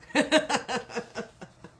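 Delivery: loud, falling burst of laughter; real, rapid-fire laugh; hearty laugh. Real, not acted. Amused by the accent teasing.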